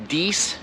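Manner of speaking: "dies" hard d and long ee; wrong long ee. Shown as a demonstration. Teacher contrasting right and wrong. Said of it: "the difference between this and".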